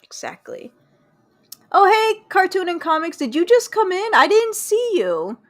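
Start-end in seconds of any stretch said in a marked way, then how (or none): 1.7-5.4 high, loud, delighted hello; surprised greeting. Real, not acted. Streamer greeting someone just come into chat, high surprised hello. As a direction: call out brightly to a late arrival.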